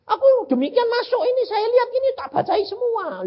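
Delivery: God's high voice. Retold or imitated. God explains in retold vision.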